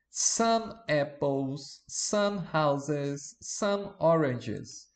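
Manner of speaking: "Some apples, some houses, some oranges" said very slowly, each word drawn out; slow model reading. Shown as a demonstration. Teacher reading plural examples to students, very slow and drawn out. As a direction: spell it out slowly for the class. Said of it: "Já no plural, como ficaria?".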